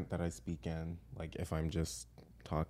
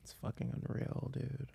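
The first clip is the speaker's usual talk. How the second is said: hushed, softer American slacker talk; chill American dude; 4 semitones higher, 8 dB quieter than usual. Shown as a demonstration. Echoing a friend's word, hushed American dude voice. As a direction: say it soft and laid-back in American.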